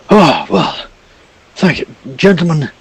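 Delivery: gushing aristocrat, shocked by the beasts; elderly noble in red robe gushing at the heroes, high and theatrical; pompous old gentleman. Acted. Noble thanking the party.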